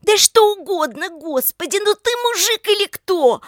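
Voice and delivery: high, loud, exasperated outburst; desperate scolding. A narrator's reading. Lika goading hesitant Oleg, loud desperate scolding. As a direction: berate him at high pitch.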